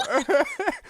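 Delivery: very high put-on cackle; mock high guffaw; imitated old man's laugh. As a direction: laugh at your own joke as the old heckler. Old guy laughing at own joke.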